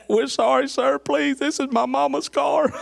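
high, slow, whiny begging through laughter; hammed-up panicked kid begging; pleading punk kids. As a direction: squeal like scared kids pleading.

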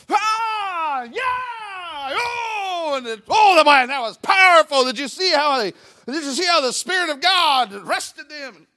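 high, drawn-out wailing awe; mock overwrought. Worshipper tied up in emotion raves, mock wailing. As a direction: imitate someone swept up in emotion.

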